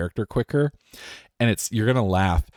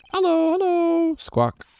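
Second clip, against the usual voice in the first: very high, piercing parrot squawk; slower than usual. Acted. Pet parrot greeting visitors, very high squawky voice. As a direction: squeak it like a talking bird.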